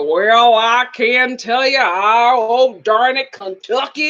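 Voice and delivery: mock American cowboy drawl; hammed-up John Wayne-style Southern accent; cowboy impression. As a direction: exaggerate a Southern cowboy twang. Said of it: "a cowboy".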